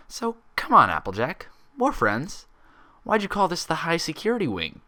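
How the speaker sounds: coaxing pressure. Acted. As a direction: lean in and coax it out.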